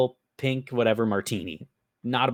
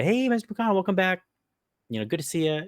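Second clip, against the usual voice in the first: higher, cheery bartender greeting; mock bartender greeting; 10 semitones higher than usual. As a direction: play the friendly bartender welcoming a regular. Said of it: "just say".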